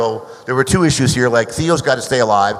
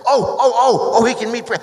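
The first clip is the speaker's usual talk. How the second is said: high-pitched retold eureka, a touch hammed-up; excited sudden idea; 10 semitones higher than usual. Producer Joel blurting out his sudden casting idea, excitedly. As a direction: mimic a producer struck by a brilliant idea. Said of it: "And then Joel said".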